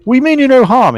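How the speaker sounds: adventurer's greeting. Acted. Adventurer promising the kobold no harm, formal greeting. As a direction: hail a strange creature in a stately character voice. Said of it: "And he says".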